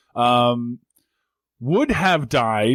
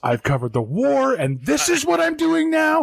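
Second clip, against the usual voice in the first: ranting anchor; 10 semitones higher than usual. Retold or imitated.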